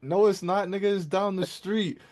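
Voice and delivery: high, loud incredulous correction; incredulous friend. Retold or imitated. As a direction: imitate a friend loudly contradicting you. Friend contradicts him in story.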